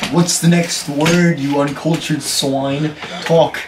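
usual speaking voice

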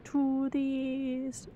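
slow, distant echo of "To the east"; slow hollow echo of "To the east"; mock echo. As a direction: draw out "To the east" like it bounces off walls. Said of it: "There is definitely an echo in this room".

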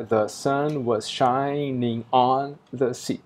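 very slow, with "on" in "The sun was shining on the sea." pushed into a beat; forced stress reading. Shown as a demonstration. Teacher forcing the sixth-syllable stress for students, slow and deliberate. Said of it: "que eu posso forçar esse acento na leitura".

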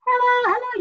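high, thin hello from the throat. Shown as a demonstration. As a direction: sing it squeezed up from the throat. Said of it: "The difference would be like".

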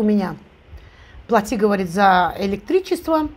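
eating between words; talking while chewing. Real, not acted. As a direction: mouth partly full. Seen 1: Takes a forkful at -1; closed-mouth chewing early, then mostly plain talking.

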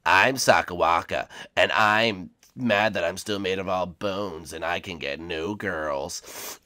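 low, soft Sakawaka voice; deep, quiet skeleton impression. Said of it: "that Sakawaka impression".

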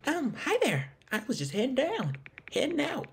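high-pitched Southern woman; Southern accent. Acted. Game character greeting the player, in a high Southern accent. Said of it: "Yeah, she sounds Southern".

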